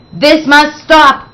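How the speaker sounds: a stern mock shout at the class. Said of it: "shouted Miss Trisk".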